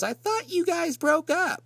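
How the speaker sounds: mock high-pitched teacher; strange teacher voice. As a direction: play the teacher as weird and out of place. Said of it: "replies with something very strange".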